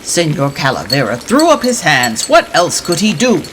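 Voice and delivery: ordinary delivery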